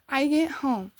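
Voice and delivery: ordinary talk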